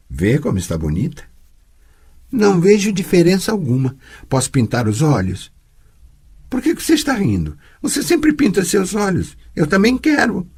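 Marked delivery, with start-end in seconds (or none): 2.3-10.5 put-on lightly higher, pleading little girl; child Ciri voice. Girl begging her teacher to paint her eyes, lightly higher pleading voice. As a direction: ask for makeup like an eager child.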